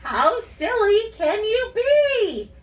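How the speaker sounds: very high, loud sing-song la la; silly monster. Pretend. Teacher pretending to be a silly purple monster, sing-song. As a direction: act goofy for the kids. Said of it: "Pretend to be a purple monster".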